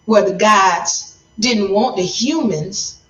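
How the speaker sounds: ordinary talk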